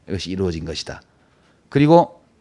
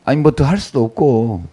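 ordinary talk

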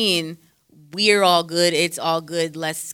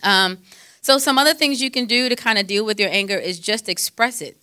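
ordinary delivery

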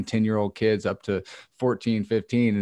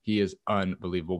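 ordinary delivery

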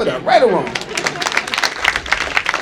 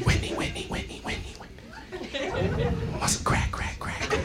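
breathy whispered calling; 6 semitones higher, 19 dB quieter than usual. Pretend. Comic whispering to Whitney about crack. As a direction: hiss her name like a secret.

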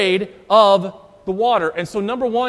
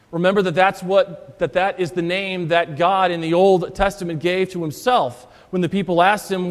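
normal talking voice

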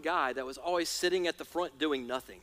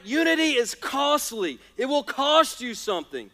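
preaching with force; 5 semitones higher, 4 dB louder than usual. Acted. Preacher's emphatic point.

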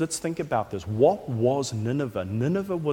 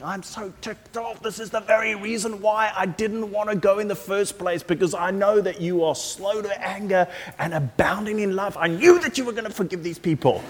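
higher, sulky griping prophet voice; exasperated Jonah; 6 semitones higher than usual. Retold or imitated. Preacher voicing Jonah griping to God, higher and sulky. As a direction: whine it like a put-out prophet complaining to God. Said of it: "and Jonah's really angry".

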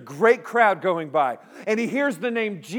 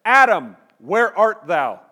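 voice of God; 9 dB louder, slower than usual. Retold or imitated. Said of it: "and God says".

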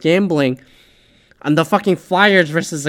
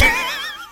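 bitter laugh; 4 dB quieter than usual. Real, not acted. Laughs at his own loss.

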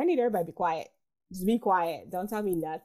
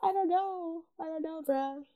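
mock despair; 8 semitones higher, 4 dB quieter than usual. Real, not acted.

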